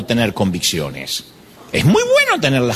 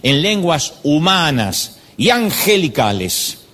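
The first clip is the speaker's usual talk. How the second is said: scripture reading; 5 semitones higher than usual. Shown as a demonstration. (lectura bíblica lenta y solemne)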